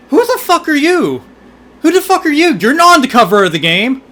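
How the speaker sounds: high-pitched, incredulous put-on demand; mock indignant challenge. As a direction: play it as a high, affronted who-are-you. Voices character challenging the heroine.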